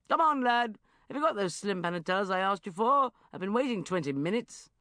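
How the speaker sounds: loud bossy uncle voice; bossy Uncle Geoffrey. Acted. Uncle Geoffrey covering up to the barman, loud and bossy.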